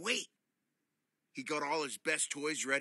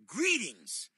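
boomed; 7 semitones higher, 6 dB louder than usual. Acted. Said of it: "boomed Rocco".

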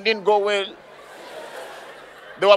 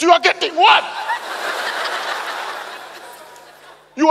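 real-sounding annoyance; 3 semitones higher than usual. Acted. Auditioner losing patience with the judges, fed-up rant. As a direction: let the frustration spill out for real. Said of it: "Now he started getting angry for it".

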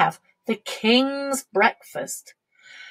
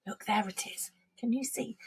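whisper; 12 dB quieter than usual. Real, not acted. Reading picture book to child.

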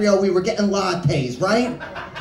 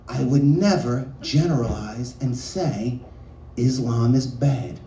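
slow patient repeat; 9 semitones lower, 4 dB quieter than usual. Retold or imitated. Repeating explanation, slower and lower.

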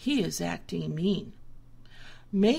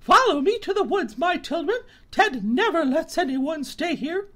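high, loud and quick; hurrying the little ones off; a turkey calling her children to the woods; anxious mother turkey; 8 semitones higher, 7 dB louder than usual. A narrator's reading. Turkey leads her children away.

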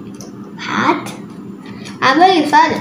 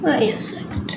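whispered aside; whispering; 3 semitones lower, 8 dB quieter than usual. Real, not acted. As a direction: whisper it under your breath. Quiet realisation while building.

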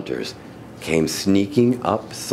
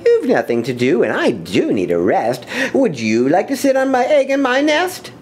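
lightly voiced bird, rising higher as she asks; coaxing lazy bird; 11 semitones higher than usual. Lazy bird coaxes the elephant to sit on her egg, high and wheedling. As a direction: sweet-talk him into it, climbing up high.

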